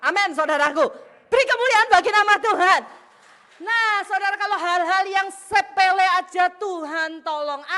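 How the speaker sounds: loud, high, exultant proclamation; full-force preaching. Real, not acted. Preacher praises God for the risen bread, full force. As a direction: proclaim it triumphantly to the whole hall.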